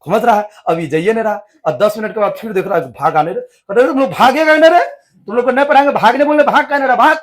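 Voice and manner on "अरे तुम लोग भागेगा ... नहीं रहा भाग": put-on raised, higher voice chasing the boys out; angry teacher scolding. Maulvi angrily shouting at stubborn students to go. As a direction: scold them sharply to leave. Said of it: "मौलवी साहब बैठा हुआ है गुस्सा में".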